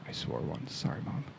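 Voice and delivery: whispered guilty apology, voice dropped to a hush; whispered aside. Real, not acted. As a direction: whisper it as if Mom might overhear. Guilty aside to absent mother.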